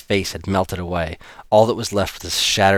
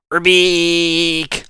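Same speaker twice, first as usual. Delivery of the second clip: loud, high croaking monster call; monster frog croak; 11 semitones higher, 5 dB louder than usual. A narrator's reading.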